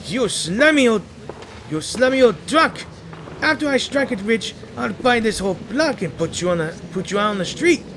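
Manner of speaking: Fleming shouting threats at Jerome; furious insult. Acted. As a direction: hurl it at the old crook.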